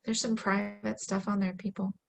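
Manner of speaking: usual speaking voice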